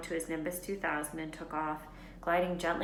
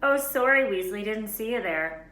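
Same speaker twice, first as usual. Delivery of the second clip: sneering; 4 semitones higher, 7 dB louder than usual. A narrator's reading. Malfoy mocking Ron.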